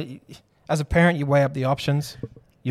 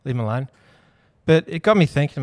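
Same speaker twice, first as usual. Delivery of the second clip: normal talking voice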